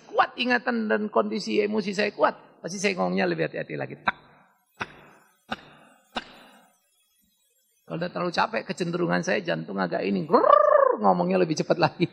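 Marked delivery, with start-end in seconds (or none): 4.1-6.3 clipped beats like a racing heart; rhythmic tak sound. Retold or imitated. Preacher mimics his heart racing when tired, tak beats. 10.3-11.0 high, loud rolling grrrr; growling grrrr. Retold or imitated. Preacher shows his heart revving when exhausted, loud grrrr.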